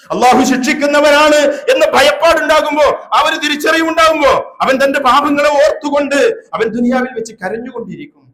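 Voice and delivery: high, loud warning from the pulpit; impassioned preaching. Real, not acted. Preacher warns believers of God's punishment, impassioned preaching. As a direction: build the warning at full force.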